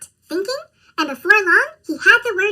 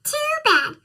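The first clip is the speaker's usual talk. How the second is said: high sing-song; 10 semitones higher than usual. Acted. Skunk scolds the goat.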